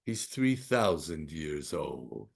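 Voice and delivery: low, slow, softened reveal; slow hushed wonder; awed realization. Retold or imitated. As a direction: let it land with quiet gravity. Retold moving realization by father.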